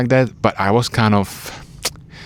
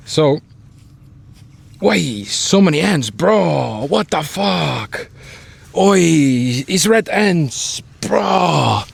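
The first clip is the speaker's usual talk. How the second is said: startled by ants; 7 semitones higher, much slower than usual. Real, not acted. Finds ants where he sits.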